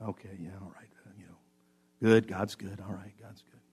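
low, muted, grudging mumble; mock-bored churchgoer, very quiet; half-hearted assent. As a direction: agree just to get it over with. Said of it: "that's not the attitude the psalmist says".

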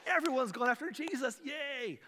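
high, bright mock celebration, loud on the name; mock cheer. Preacher mocking the Pharisees as if they cheered. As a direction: sing it out like a cheering fan. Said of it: "They're not saying".

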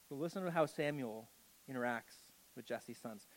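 ordinary talk, nothing marked

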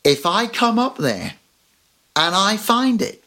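voicing Mum. Mum warning her kid upstairs, lightly voiced threat. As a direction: warn the kid in measured, separate phrases. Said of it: "then what does she say".

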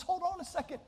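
high, soft mock outcry; retold cry in a small high voice. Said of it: "he's crying out".

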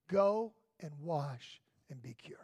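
breathy whisper, words barely voiced. Officers quoting the prophet's command to Naaman, whispered. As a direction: hush it like a secret you're letting them in on.

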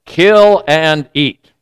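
slow, measured command; commanding heavenly voice. Retold or imitated. A voice speaks to Peter in his dream, slow command. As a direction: deliver it slowly as a solemn order. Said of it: "the voice says to Peter".

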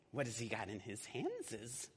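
slow suspicious murmur; Gollum voice. Acted. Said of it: "I do the voices".

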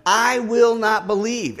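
lightly voiced doubting Thomas. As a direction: press it like a stubborn doubter. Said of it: "And Thomas said".

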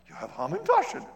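high, loud, delighted gasp of a question; a neighbor amazed there are cookies; thrilled surprise. Retold or imitated. Neighbor thrilled to be offered Hamantaschen, excited.

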